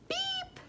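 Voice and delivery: soft, very high beep; a small, gentle horn toot; little truck beep. A narrator's reading. Narrator voicing Blue among the animals, soft high beep. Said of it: "but Blue went".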